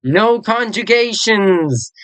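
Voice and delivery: high, slow joyful announcement; excited cheer. Real, not acted. Teacher cheering to students over no conjugations, excited. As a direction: celebrate the news, pitch up.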